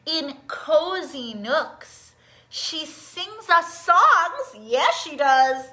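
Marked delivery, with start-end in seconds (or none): 0.1-4.6 slow storybook reading. A narrator's reading. Reading picture book aloud.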